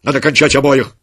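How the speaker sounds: loud, harsh commands; harsh order. A narrator's reading. Legionary ordering his soldiers to kill, loud and harsh. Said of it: "приказал он солдатам".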